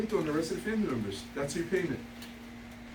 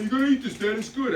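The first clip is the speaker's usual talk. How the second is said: lightly voiced kid, pitched higher; imitated eager kid; 6 semitones higher, 3 dB louder than usual. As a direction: playfully mimic a son coaxing his dad. Voices his kid pushing the cookie.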